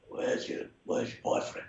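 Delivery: raspy whispered voice, hushed and breathy. Retold or imitated. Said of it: "You do a great imitation of [name]".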